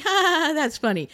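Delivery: high mock ha-ha-ha; imitated laughing onlookers. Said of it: "people around us are even laughing".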